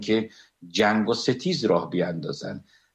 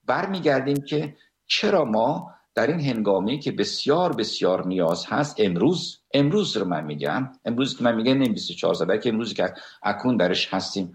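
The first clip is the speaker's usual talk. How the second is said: normal talking voice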